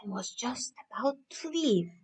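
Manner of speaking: hushed sneaking whisper; breathless whisper of a boy sneaking off. A narrator's reading. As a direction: whisper it as if tiptoeing away. Sneaking away with the harp.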